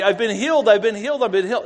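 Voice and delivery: high, loud repeated exclaiming; elated mock yell; excited yelling. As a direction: imitate someone yelling the news to everyone. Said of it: "starts yelling at everybody".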